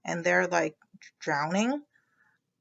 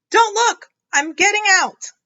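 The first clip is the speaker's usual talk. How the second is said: much higher, louder panicked cry; panicked shout; 11 semitones higher, 8 dB louder than usual. Acted. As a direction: shout it embarrassed and urgent.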